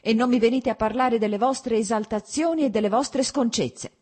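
high, loud angry tirade; cutting shout. A narrator's reading. Wife forbidding talk of his indecencies, cutting and loud. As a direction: lash out sharply at full voice. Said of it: "gridò con voce ancora più tagliente".

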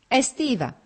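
high call up to a balcony; narrated cry of recognition, not a full shout; excited call. A narrator's reading. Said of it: "gridò Lievin da sotto al balcone".

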